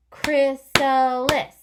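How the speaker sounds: chrysalis said slow, high and loud; stretched out. Shown as a demonstration. Teacher modelling chrysalis for the class, slow and loud. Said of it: "stretch it out".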